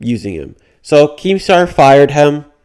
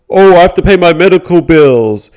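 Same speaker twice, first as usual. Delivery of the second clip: higher, louder mocking imitation; mock excuse; 6 semitones higher, 6 dB louder than usual. YouTuber grilled about crypto scams, mock protesting excuse. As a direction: parody someone pleading an excuse, pitched up and loud. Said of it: "when Coffeezilla grilled him about it".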